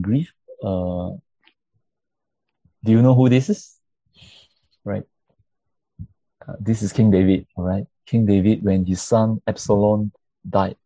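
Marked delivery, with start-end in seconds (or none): none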